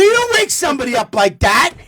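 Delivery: high, loud, worked-up rant; lecturing his new girlfriend in outrage; hammed-up high-pitched scolding; indignant rant. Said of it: "I go".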